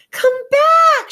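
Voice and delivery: put-on high, loud girl's cry after the balloon; desperate scream. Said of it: "she screamed".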